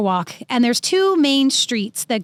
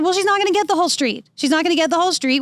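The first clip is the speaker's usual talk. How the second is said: firm retort; 6 semitones higher, faster than usual. Retold or imitated. Acted retort to yelling driver.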